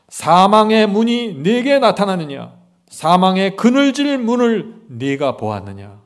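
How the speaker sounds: slow proclaimed Bible verse; scripture reading. Acted. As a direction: proclaim each word slowly.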